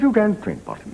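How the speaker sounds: high-pitched incredulous colonel; hammed-up officer's surprise; commanding officer. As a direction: query it like a baffled British CO. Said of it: "people who talk like that".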